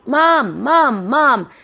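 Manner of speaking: high, insistent call for mum; mock shout. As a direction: keep calling Mum across the aisles. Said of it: "I always shout".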